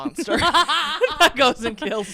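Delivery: high, slow words through laughter; laughing. Real, not acted. Host jokes she is the monster who kills, laughing. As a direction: crack up while joking about being a monster. Joking about herself, laughing.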